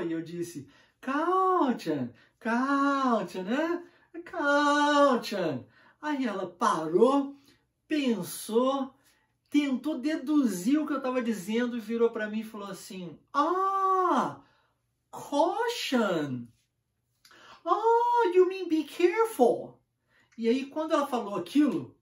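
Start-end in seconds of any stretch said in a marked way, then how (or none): 1.0-5.6 "Caution" as "cal-tchan", higher and louder each time; insistent mispronunciation. Retold or imitated. Frustrated learner insisting on his word to the confused girl, higher and louder. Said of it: "pronunciado cal e T I O N". 13.3-19.8 high, louder dawning "Oh, you mean be careful"; girl's sudden realization. Retold or imitated. The gym girl realizing what [name] meant, high surprised voice. Girl finally understands him.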